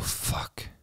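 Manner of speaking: breathless whisper of dread; terrified whisper to himself; whispered curse. Retold or imitated. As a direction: barely breathe the word out. Inner thought, killer behind him.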